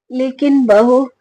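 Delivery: ordinary delivery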